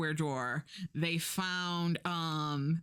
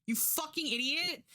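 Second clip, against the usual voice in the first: slow, higher, spelled-out scolding; slow, pointed mock exasperation; exasperated; 11 semitones higher than usual. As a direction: lay it out like she's dense. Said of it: "And I'm like".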